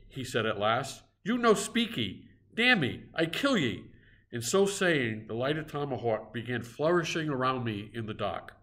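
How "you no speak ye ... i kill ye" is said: higher pidgin threat, broken English; threatening cannibal. A narrator's reading. Harpooner threatens the silent stranger, raised threatening voice. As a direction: menace him in halting words.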